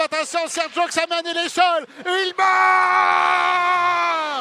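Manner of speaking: building from alert to a high, long shouted goal call; excited sports commentary. Real, not acted. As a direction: stretch out the goal call as the crowd roars.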